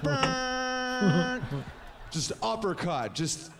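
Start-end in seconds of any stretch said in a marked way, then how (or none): 0.0-1.6 high, held horn blast; nasal horn honk. Acted.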